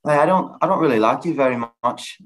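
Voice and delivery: Irish accent that slips into British; attempted Irish accent. Shown as a demonstration. Asked to redo his impression, attempted Irish accent. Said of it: "Do it again".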